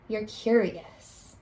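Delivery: "You're curious" slow and soft; slow tender teacher affirmation; gentle summary. Shown as a demonstration. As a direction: tell the child kindly, slowly. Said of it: "that word curious means".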